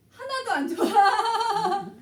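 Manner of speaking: a drawn-out, loud, slow protest breaking into a laugh. Real, not acted. Telling listeners America is not good at all, slow and laughing. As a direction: exaggerate how bad it is, then laugh.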